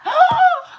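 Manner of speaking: sharp, high gasp of delight; a sudden high gasp, thrilled. Real, not acted. Hearing the kitten news, a high delighted gasp. Hearing they may take the cat.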